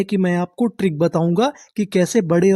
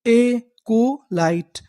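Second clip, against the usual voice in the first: एकोलाइट stretched slowly, syllables apart; slow teaching read; much slower than usual. Shown as a demonstration. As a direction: sound it out for the class. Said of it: "तो पूरी meaning क्या बनी?".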